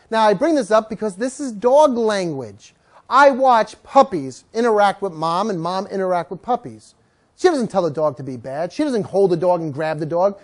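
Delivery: ordinary delivery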